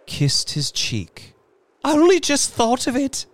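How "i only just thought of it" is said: high, fast, loud hysteria; hysterical. Acted. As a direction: gush it out hysterically. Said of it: "she said hysterically".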